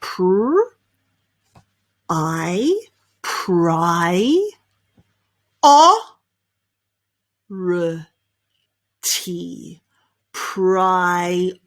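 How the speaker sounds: PRIORITY broken up: pry, OR, ruh, tee; syllable-by-syllable demo. Shown as a demonstration. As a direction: sound out PRIORITY with long gaps. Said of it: "the way to pronounce this is".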